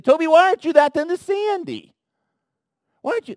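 loud, high, scolding demand; the wrong, harsh way God does not say it. Pretend. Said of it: "he doesn't say it with that tone".

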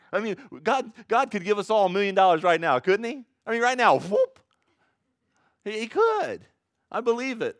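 fiery pulpit exclaim; full-force preaching. Real, not acted. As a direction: lift the room with excitement.

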